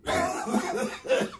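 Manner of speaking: real dry coughing from a sore throat; coughing fit. Real, not acted. Streamer after imitating the bad audio, coughing. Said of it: "That hurt my throat".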